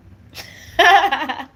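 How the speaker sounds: loud, high-pitched giggling burst; burst of laughter. Real, not acted. Laughs after the hitchhiking story.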